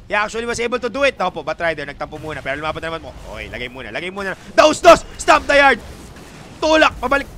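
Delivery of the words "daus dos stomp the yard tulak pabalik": high and loud play call; hype call. Real, not acted. Caster calling the fight to viewers, excited.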